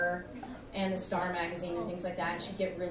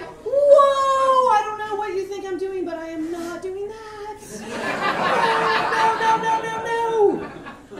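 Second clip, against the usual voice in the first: much higher, loud alarmed yells; shocked shouting protest; 13 semitones higher, 10 dB louder, slower than usual. Retold or imitated. Patient shocked by the doctor's exam, panicked shouting.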